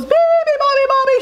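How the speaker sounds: very high, loud seal shriek. A narrator's reading. Seals wake the sleeping bird, shrieking. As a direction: shriek the chant at the top of pitch. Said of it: "shrieked the seals".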